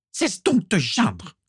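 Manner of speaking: mother silencing her whining husband, curt; curt rebuke. A narrator's reading. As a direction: cut him off coldly. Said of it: "le coupa sèchement Walburga".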